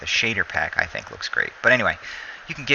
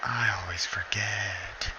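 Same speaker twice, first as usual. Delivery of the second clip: slow voiceless whisper of "I always forget"; slow self-directed whisper; 5 dB quieter, slower than usual. Real, not acted. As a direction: mutter it to yourself, barely voiced. Muttered repeat of own line.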